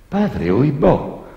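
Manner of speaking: put-on coy girl voice. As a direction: gasp it out like a prim girl. Girl answers the priest.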